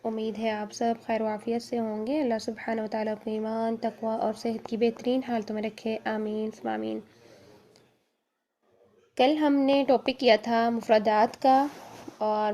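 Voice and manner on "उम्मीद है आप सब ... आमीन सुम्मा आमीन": quiet, soft opening prayer; teacher greets students with a soft, formal blessing; soft formal greeting. Acted.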